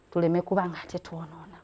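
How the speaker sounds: ordinary talk, nothing marked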